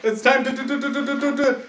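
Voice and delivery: high, hammed-up stuttering announcer; mock announcer. GM announcing duel time to the boys, hammed-up stammering announcer. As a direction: stammer it for laughs.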